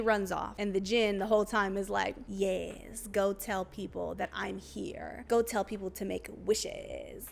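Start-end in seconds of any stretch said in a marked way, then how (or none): 2.3-7.2 drawn-out, savoring creature voice; slow mock-villain delivery; Jin's voice. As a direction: imitate a sinister wish-granter, stretching the words. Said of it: "That's how he talks".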